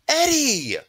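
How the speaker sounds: high, loud scream of a name; shrieked call; screaming girl. A narrator's reading. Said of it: "screamed one of the girls".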